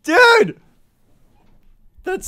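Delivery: high, loud exclamation; amused disbelief. Real, not acted. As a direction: exclaim it in amazed delight. Amazed reaction to message.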